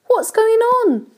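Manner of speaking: high-pitched startled question; alarmed cry. A narrator's reading. James leaping from his hammock, alarmed cry. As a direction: cry out high, wanting to know. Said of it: "cried James".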